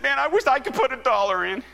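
loud, high wailing imitation; tearful man. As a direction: wail the wish as a crying man. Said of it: "he was just a-crying".

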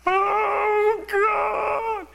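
high, loud anguished wail; sobbing shout from the back of the church; wailing cry. Retold or imitated. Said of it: "wailing out".

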